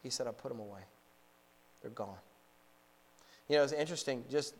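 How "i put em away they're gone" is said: low, hushed and final; the grandfather quietly announcing he's quit, hushed; hushed quote. Retold or imitated. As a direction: say it softly, like closing a door.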